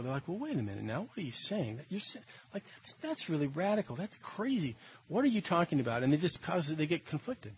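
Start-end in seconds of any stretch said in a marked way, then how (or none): 0.3-6.0 uncomfortable. Retold or imitated. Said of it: "Some people get uncomfortable".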